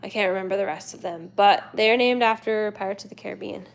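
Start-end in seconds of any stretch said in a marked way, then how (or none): none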